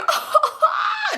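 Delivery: very high-pitched mock howl of laughter; hammed-up hysterical laughing squeal; imitated cackle. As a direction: shriek with put-on laughter. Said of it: "They just dying laughing".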